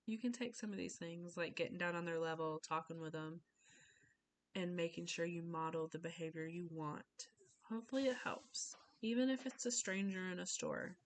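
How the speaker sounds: normal talking voice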